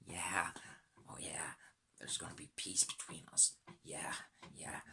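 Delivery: hushed, sly mock reassurance; mock fake-friendly hush. Mocking world leaders faking peace, softly sly.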